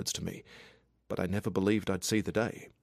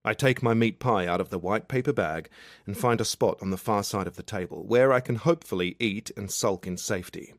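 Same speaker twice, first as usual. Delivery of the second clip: plain reading voice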